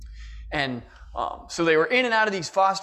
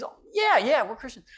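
lightly voiced mother, much higher; mother's breezy reply; 5 semitones higher than usual. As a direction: toss off a high, airy "Oh, yeah" like a mom. Mother answering young Ben.

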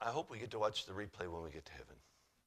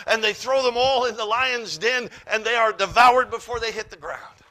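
loud, high, ringing scripture reading; preaching scripture; 11 semitones higher, 14 dB louder than usual. Acted. Preacher booming the Bible story to the church. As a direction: proclaim the king's words to the congregation.

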